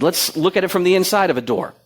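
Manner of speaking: ordinary delivery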